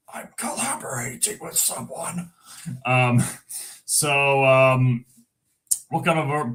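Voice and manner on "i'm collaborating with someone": high, soft whispery character voice; put-on squeaky voice. Answers himself in a put-on voice.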